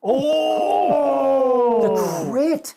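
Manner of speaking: high, loud cheer; real excited shouting, voice soaring; elated outburst. Real, not acted. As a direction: cry out in triumph at a lucky roll. Celebrates a critical dice roll.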